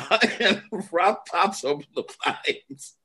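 laughing. Real, not acted. Storyteller cracking up at own memory.

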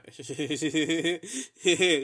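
high, loud cackling laugh; imitated cackle. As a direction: cackle like the mom did. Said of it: "she started laughing exactly like that too".

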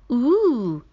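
teasing ooh. Acted.

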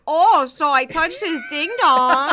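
slow, loud Midwest drawl; Midwest accent. Retold or imitated. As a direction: read romance in a Midwest accent. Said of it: "in a Midwest accent".

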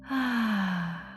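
exhaled sigh of release; audible sigh. Shown as a demonstration. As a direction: let the breath fall out with a sigh. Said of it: "And exhale with a sigh".

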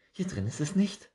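high, bright squirrel voice rising into alarm; a fussy little squirrel who suddenly misses a leaf; excitable squirrel. Acted. Squirrel searching.

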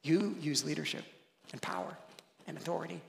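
soft, low, spaced-out phrases; quiet correction. Acted. Experienced kingdom member explains leadership to newcomer, hushed correction. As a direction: set it down gently, pausing between phrases.

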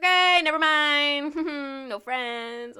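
loud, high, drawn-out backing off; mock cheerful retreat. Vlogger abandoning dating app after a scary story, mock cheer. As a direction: call it out slowly, giving up with a laugh.

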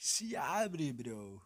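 a hint of João Frango's bro; imitating João Frango. Repeating the João Frango catchphrase, lightly voiced. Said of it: "imitar o João Frango".

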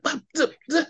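high flustered babble; stammering noises. Retold or imitated. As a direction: sputter like you can't find words. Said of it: "I was like".

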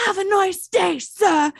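high, loud London motorist's send-off; imitating angry yell. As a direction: yell it like a fuming but polite Londoner. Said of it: "he starts yelling at the guy".

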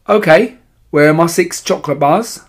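lightly voiced bratty boy making demands; demanding boy. A narrator's reading. Greedy boy demanding chocolate.

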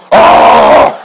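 loud, low bellowed "ah"; acted yell. Pastor re-enacts church antics, loud mock bellow. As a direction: bellow like someone seized by the anointing. Acts out yelling under the anointing.

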